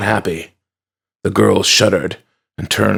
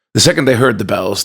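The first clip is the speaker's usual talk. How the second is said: plain reading voice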